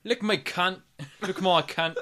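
loud put-on accent drifting into Australian; Australian-sounding accent. Co-host trying out a British woman's line, Australian-sounding accent. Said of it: "She's Australian".

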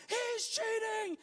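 put-on very high, drawn-out child's screech; imitated tantrum scream. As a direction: mimic a wailing kid screaming his protest. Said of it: "between the tears and the sobs".

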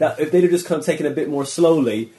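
high, loud excited insistence; building up loud and eager. Real, not acted. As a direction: push the point hard and bright.

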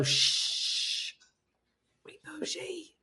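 very soft, whispered shush; mock hushing whisper. Hushing fans at posh tennis and golf, mock whisper. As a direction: hush the crowd in a playful whisper.

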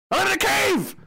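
high, shrill cry of despair; high mock shout. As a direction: yell it like you're trapped. Shouting imitation of the cave clip.

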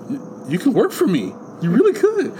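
mock-thrilled, squeaky rise on the last words; acted eager patient. Co-host acting out Bob after a breakthrough, mock-eager. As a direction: voice leaping high on the end.

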